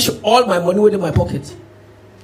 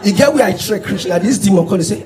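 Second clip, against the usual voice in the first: ordinary delivery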